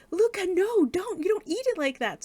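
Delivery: high, loud panicked stop; urgent warning. Retold or imitated. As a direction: cry out to stop him mid-bite. Ruby urgently stops Luca eating.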